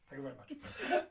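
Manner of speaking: high laughing thanks. Real, not acted. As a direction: laugh through the cheeky thanks. Cheeky request for everyone's Scotch.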